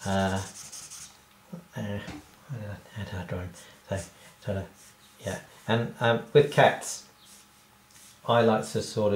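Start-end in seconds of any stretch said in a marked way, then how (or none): none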